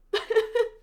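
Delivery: a loud, high, wet laugh through crying; teary laugh-sob. Real, not acted. Reader overcome mid-recap, teary laugh-sob. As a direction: let the sob escape as a laugh. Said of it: "I cried the first time I read this scene".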